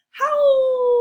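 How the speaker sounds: high, loud howl; wolf howl. Acted. Wolf sound in the story.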